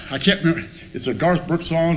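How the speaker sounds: ordinary talk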